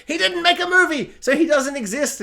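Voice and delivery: high, loud spelling-out of the point; emphatic outburst. Acted. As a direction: blurt out the hidden meaning. Shouts out the joke's subtext.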